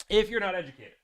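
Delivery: ordinary talk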